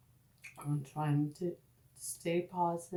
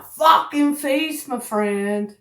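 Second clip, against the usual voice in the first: loud exasperated outburst; 7 semitones higher, 13 dB louder, slower than usual. Real, not acted. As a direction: blurt it out in disbelief. Outburst about her face.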